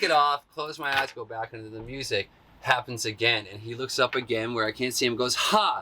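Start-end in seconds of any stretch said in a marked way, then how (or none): 5.4-5.8 high, punched "ha!"; quick high burst, as the drummer did; imitated drummer's shout. Drummer looks up at the dancer again, sudden high "ha!".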